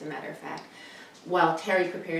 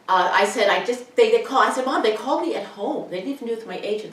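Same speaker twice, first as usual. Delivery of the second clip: much louder, higher, breathless excitement; excited; 5 semitones higher, 9 dB louder than usual. Retold or imitated. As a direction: gush about the big news. Retelling Seinfeld call excitedly.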